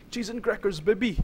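high, whooping catchphrase; high-pitched gleeful holler; excited exclamation. Real, not acted. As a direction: cry it out high in the thrill of the jump. Exclaims a catchphrase mid-jump.

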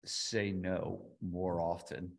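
usual speaking voice

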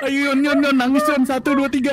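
high, loud teasing countdown; egging on. Real, not acted. Streamer cueing a friend to cry on three, loud egging on.

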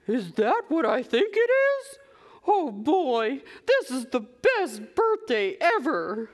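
high, drawn-out delighted wonder; thrilled Cow. Acted. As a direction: gush over a birthday surprise. Cow thrilled at birthday surprise.

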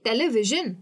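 "Television" with pitch lifted on VI; teleVIsion, stressed on VI. Shown as a demonstration. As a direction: raise VI in "television", then fall. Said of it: "मेरा pitch high हो जाएगा".

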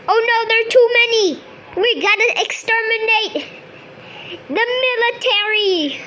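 excited. Pretend. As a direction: blurt it out in a panic. Excited panicked narration.

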